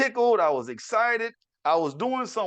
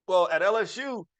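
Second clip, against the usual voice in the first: usual speaking voice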